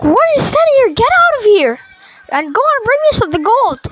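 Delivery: high-pitched mock scolding; bossy villain. Malgosha orders her followers out and after gold, bossy.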